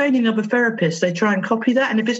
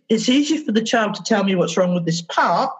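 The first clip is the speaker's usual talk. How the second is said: usual speaking voice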